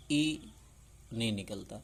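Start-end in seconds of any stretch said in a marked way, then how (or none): 0.1-0.4 ای said as a short, clean ee with no drag; ای clipped short; short i sound. Shown as a demonstration. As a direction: demonstrate ای as a quick ee. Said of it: "حمزہ زیر ی بول دیتے ہیں".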